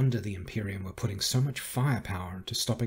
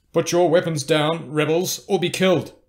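loud, raised-pitch order; commanding voice; 7 semitones higher, 9 dB louder than usual. A narrator's reading. Enemy ordering rebels to surrender from darkness, commanding shout. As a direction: call it out forcefully across the dark. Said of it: "a deep voice said from the darkness".

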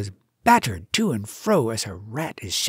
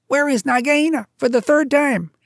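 higher, louder impatient mongoose; urgent, fed-up mongoose; Rikki Tikki; 11 semitones higher, 6 dB louder than usual. A narrator's reading. Mongoose tells the bird to stop singing, impatient.

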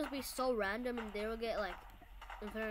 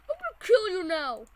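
threatening shout; 6 semitones higher, 8 dB louder than usual. Real, not acted. Threatening enemy in game.

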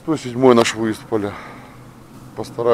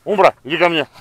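calling the dog; 6 semitones higher, 5 dB louder, faster than usual. Real, not acted.

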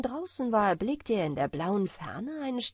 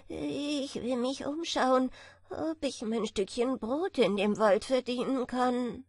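plain reading voice

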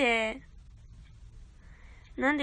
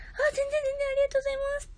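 much higher, cutesy squeaky thank-you; 13 semitones higher than usual. Shown as a demonstration. Streamer showing off her high voice to chat. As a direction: pitch it way up like a cute idol. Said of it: "高い声も出せるし".

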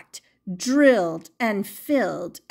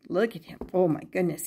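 ordinary delivery, nothing marked

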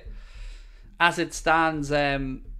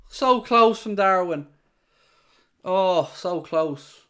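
slow, drawn-out, higher than usual; real excitement, stretching the words out slowly; agonised near-miss reaction; 4 semitones higher, 5 dB louder, much slower than usual. Real, not acted. Fan reacts to Darwin going so close, drawn-out anguish.

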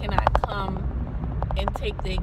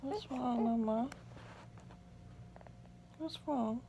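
soft, higher-pitched coo; 4 semitones higher, 10 dB quieter than usual. Real, not acted. Mother asking her baby what's wrong, soft and soothing. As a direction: gently coo to a fussing newborn.

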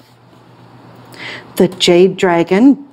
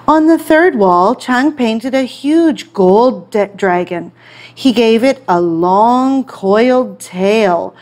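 usual speaking voice

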